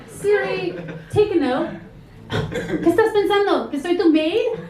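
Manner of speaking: offended mom snapping back in Spanish. Pretend.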